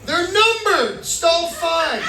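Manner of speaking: high, loud acted explanation; mock insistent announcement; emphatic aside. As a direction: push it up high and slow to make the point.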